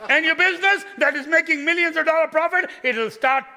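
high, loud, booming joke to the crowd; full-force preaching. Acted. Pastor jokingly offers the congregation a prayer, preaching loudly. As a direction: preach it big and loud, grinning.

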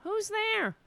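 put-on very high-pitched old lady voice; old lady calling out. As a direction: imitate an elderly neighbor calling through an open door. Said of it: "The old lady was like".

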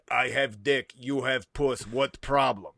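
lightly voiced German accent; deadpan German-accented lyric; mock German lyrics.

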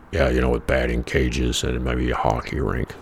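a hint of a dull know-it-all; annoying guy voiced.

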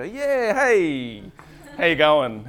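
high, loud fake-friendly hello; mock cheery greeting. Husband bluffing friendliness to a supermarket stranger, bright mock greeting.

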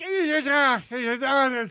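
high, strangled "ah, ah" cries; re-enacted shocked stammer. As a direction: stutter out cries as if electrocuted. Re-enacting being unable to speak after shock.

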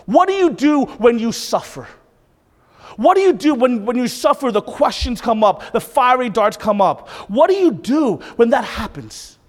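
loud, high challenging questions; forceful preaching. Real, not acted. Preacher asking what you do in suffering, forceful preaching. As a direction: press the question hard on the congregation.

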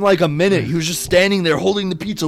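ordinary talk

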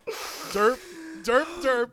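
dopey derp voice, rising. Pretend. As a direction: blurt it like a dumb meme. Mocking meme sound.

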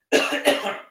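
sharp cough fit; real cough. Real, not acted. Host coughing again on air. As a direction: cough once, hard. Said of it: "sorry about that".

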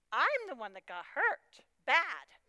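usual speaking voice

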